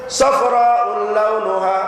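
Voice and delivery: slow, drawn-out chanted Arabic; preacher intoning scripture slowly; Quranic recitation. Acted. Quoting Quran verse in Arabic.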